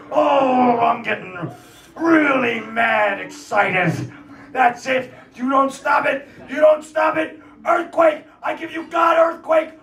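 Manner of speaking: high-pitched mock tantrum; flustered angry God. God threatening Sodom with an earthquake, hammed-up fury. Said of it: "You're telling me that God was looking".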